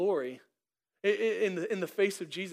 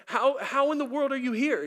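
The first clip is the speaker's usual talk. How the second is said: amazed angel; 7 semitones higher, 4 dB louder than usual. Acted.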